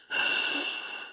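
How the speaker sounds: exaggerated breathy intake of excitement; big put-on gasp; mock gasp. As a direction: gasp like a giddy fan. Mocking an excited livestream fan.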